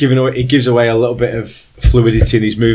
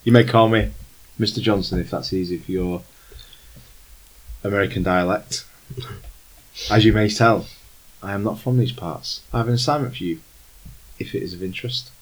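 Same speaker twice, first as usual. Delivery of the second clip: a lightly voiced formal stranger, unhurried; Germanish accent. Game master as the old stranger offering the players a job, lightly German. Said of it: "he says".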